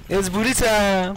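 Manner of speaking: high, drawn-out catchphrase shout; slow, high-pitched catchphrase impression; Cyborg. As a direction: stretch it out high like a cartoon hero. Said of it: "a cyborg accent".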